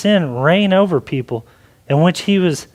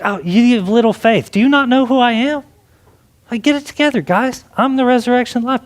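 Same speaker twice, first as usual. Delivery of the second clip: higher, quick-fire imagined rebuke; mock exasperated scolding; 6 semitones higher than usual. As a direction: play at chiding doubters who should know better.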